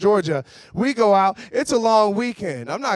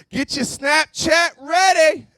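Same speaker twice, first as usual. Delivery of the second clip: party hype; 9 semitones higher, slower than usual. Pretend. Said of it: "you're like".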